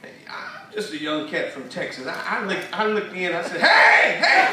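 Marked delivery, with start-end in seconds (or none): 3.6-4.5 high, loud call into a dark hole; nervous loud hello into the dark. Retold or imitated. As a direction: shout hey into the darkness, half laughing. Calling into the dark tomb.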